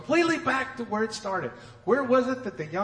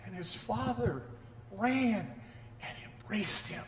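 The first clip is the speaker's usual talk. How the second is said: hushed awe; 10 dB quieter than usual. Acted. Tender climax of the parable.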